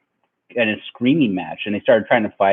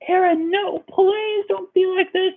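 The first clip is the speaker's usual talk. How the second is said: mock pleading girlfriend. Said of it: "She's like".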